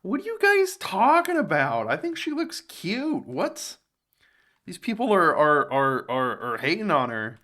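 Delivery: hammed-up shocked defender; mock outrage. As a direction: play an indignant fan defending her. Mock indignant high defence.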